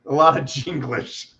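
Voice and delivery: amused laughing repeat; a laughing echo of the word. Real, not acted. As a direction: laugh the phrase back.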